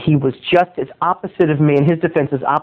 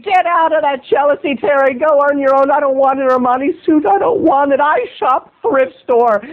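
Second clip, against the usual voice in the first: high, whiny hammed-up voice; mock whiny self-talk; 9 semitones higher than usual. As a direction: whimper defensively at yourself. Mocking whiny voice, self-pity.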